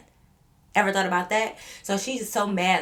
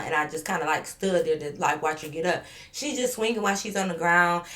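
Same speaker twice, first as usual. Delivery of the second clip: normal talking voice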